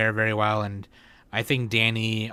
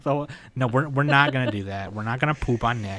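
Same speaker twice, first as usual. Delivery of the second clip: ordinary talk, nothing marked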